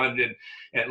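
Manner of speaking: ordinary talk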